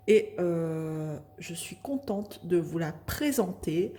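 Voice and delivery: normal talking voice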